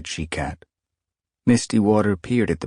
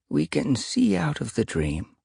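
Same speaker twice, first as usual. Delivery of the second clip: ancient she-cat; 9 semitones higher than usual. A narrator's reading. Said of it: "Stoneteller told him".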